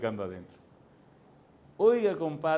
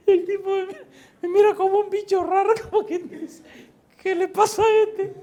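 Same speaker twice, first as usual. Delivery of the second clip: high, loud words squeezed through real laughter; laughing hard; 9 dB louder than usual. Real, not acted. Preacher telling how the stranger stared at him, laughing hard. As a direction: barely get it out for laughing.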